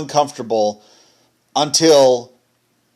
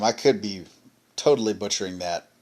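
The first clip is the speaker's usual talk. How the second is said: ordinary delivery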